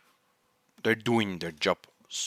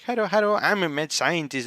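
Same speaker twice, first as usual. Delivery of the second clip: higher, sing-song mockery of his boast; mocking Kurisu; 6 semitones higher, faster than usual. Kurisu mocking Okabe's boast, higher teasing sing-song. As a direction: parrot his line back in a teasing lilt. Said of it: "and she's like".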